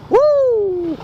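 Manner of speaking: high whoop of thrill; excited whoop. Real, not acted. Exhilarated shout.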